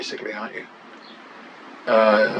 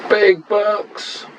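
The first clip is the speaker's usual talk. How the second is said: excited; 9 semitones higher than usual. Real, not acted. Hoping for a big win.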